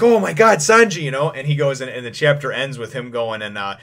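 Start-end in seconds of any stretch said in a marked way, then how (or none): 0.0-1.0 high, loud joyful cry; excited shout, imitating an overjoyed character. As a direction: act out relief at seeing your rescuer. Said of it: "Vivi's overjoyed".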